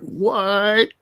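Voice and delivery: rising panicked disbelief; shocked. Retold or imitated. Shocked viewer quote.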